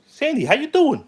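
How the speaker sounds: high, sing-song voice for the cat; pet talk. Real, not acted. Greeting his cat Sandy, high cooing pet talk. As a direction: coo at the cat like talking to a pet.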